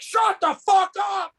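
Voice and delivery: high, slow furious shout; angry yell. Retold or imitated. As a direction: yell it at him, fed up. Angry guy turns around, yells.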